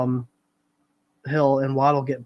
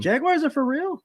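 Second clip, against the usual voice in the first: surprised question; 13 semitones higher than usual. Real, not acted. Reacts to panel picking Jaguars.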